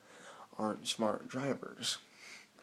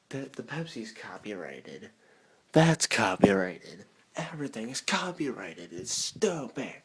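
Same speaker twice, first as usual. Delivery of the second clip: mock rant; 8 dB louder than usual. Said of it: "No, I'm just kidding".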